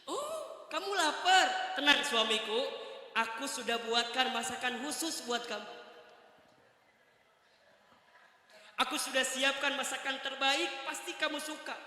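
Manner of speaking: a sugary ghost wife promising food; high doting wife's voice offering dinner; high sweet wife. Retold or imitated.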